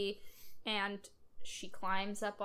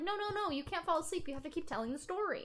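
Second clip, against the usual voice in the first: put-on pleading little girl; 7 semitones higher, faster than usual. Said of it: "And she's like".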